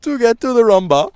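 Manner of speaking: put-on Caribbean accent. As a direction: belt it in a hammy Caribbean accent. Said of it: "in a Caribbean accent".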